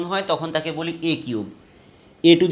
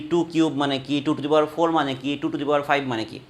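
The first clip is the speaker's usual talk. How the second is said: normal talking voice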